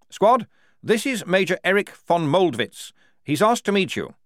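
high, eager officer's voice, announcing; a keen young officer presenting his guest; Lieutenant Blouse. A narrator's reading. Blouse introducing the major to the squad, high and eager.

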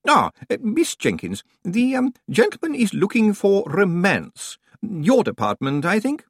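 high, hesitant, distracted old librarian; Senior Librarian Swinsley. A narrator's reading. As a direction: mumble it while rummaging, fluttery and high.

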